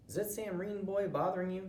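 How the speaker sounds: high, concerned mother asking; a mom fretting over her boy; worried mom voice. Acted. Worried mom questions son.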